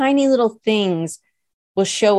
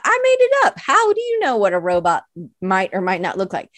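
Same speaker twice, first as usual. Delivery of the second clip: mock indignant retort. Artist answers a critic of the robot drawing, mock indignant. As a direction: squeak up in playful defensiveness, then settle.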